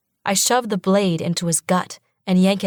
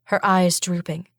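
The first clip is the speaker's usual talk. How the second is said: usual speaking voice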